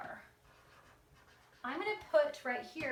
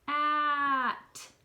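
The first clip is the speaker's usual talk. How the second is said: slow stretched sound; 4 semitones higher, 5 dB louder than usual. Shown as a demonstration. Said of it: "Say the fast way".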